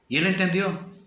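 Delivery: ordinary delivery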